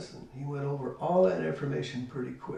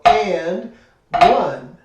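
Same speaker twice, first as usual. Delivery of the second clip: and louder than one, like a drum accent; 4 semitones higher, 13 dB louder than usual. Shown as a demonstration. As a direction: punch the and, let one follow. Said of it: "you feel your right hand go".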